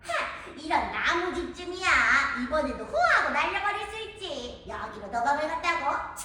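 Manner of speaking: a sly, hungry wolf with a howl and sneaky chuckles; the hungry wolf sniffing out the piglets; hungry big bad wolf. Wolf again at wood house. (돼지고기 냄새를 맡는 배고픈 늑대)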